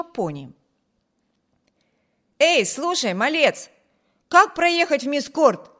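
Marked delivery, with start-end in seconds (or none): 2.4-5.7 louder, bossy, higher call; commanding tone. A narrator's reading. Wibert demanding directions from a village boy, commanding tone. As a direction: order the boy to answer. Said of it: "повелительным тоном".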